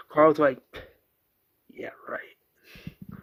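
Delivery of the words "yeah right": hushed sarcastic reply; barely audible whispered comeback; dry retort. Retold or imitated. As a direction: toss it off under the breath. Carl's dry retort to Andrea.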